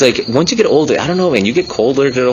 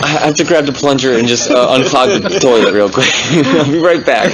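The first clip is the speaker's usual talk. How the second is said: high giggling put-on voice; laughing mock girlfriend; 4 semitones higher than usual. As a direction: play the girlfriend through laughter.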